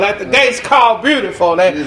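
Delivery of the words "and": fiery preaching. Real, not acted. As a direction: whoop it up to the congregation. Preaching at full force.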